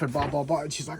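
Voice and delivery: ordinary delivery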